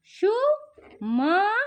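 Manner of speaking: Suman stretched very slowly with a rising end; patient teacher sounding out; slow blending. Shown as a demonstration. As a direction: draw Suman out syllable by syllable. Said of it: "तो पहले सा".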